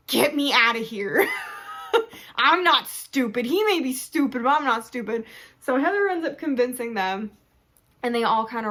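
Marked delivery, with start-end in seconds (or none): 0.1-1.3 wife snapping at her husband, raised; Liesel's voice. Retold or imitated. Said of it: "the wife, is like". 1.3-2.3 high squeal of a laugh. Real, not acted. Laugh inside Liesel's quote. 2.4-5.2 wife snapping at her husband, raised; Liesel's voice. Retold or imitated. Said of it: "the wife, is like".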